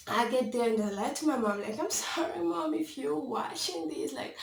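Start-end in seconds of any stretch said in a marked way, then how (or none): 1.8-4.1 much higher voice, slowing into a plea; sheepish apology. Real, not acted. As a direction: apologise sweetly to mom through the camera. Apologises aside to watching mom.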